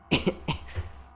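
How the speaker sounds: dry cough from a cold. Real, not acted. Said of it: "I kinda caught a little cold".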